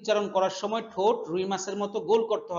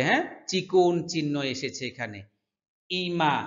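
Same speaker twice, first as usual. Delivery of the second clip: ordinary talk, nothing marked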